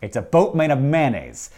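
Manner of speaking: ordinary delivery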